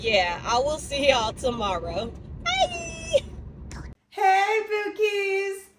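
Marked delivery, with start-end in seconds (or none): 2.4-3.2 very high, squeaky goodbye; high sign-off. Real, not acted. Signing off to viewers until tomorrow, high-pitched goodbye. 4.1-5.7 high, held-out hello; high singsong greeting. Real, not acted. Opening a new day's vlog for viewers, high singsong greeting.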